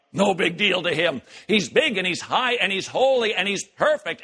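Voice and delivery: normal talking voice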